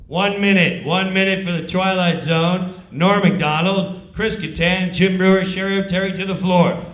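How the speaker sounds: loud call across the studio; stage manager call. Retold or imitated. Comic imitates stage manager calling cast, loud. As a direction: summon the cast to the floor.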